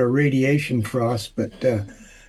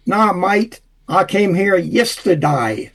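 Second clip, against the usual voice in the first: higher-pitched Aussie joke voice; Australian accent; 4 semitones higher, slower than usual. Acted. Australian driver answering a trooper in a joke, Australian accent. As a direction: put on an Aussie drawl for the punchline. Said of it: "Good job with the accent".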